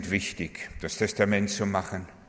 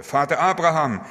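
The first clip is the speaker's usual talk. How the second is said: higher, louder appeal; pleading; 5 semitones higher, 5 dB louder than usual. A narrator's reading. Rich man begging Abraham in the parable, pleading. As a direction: call out imploringly, as narrated. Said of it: "bat der Reiche jetzt".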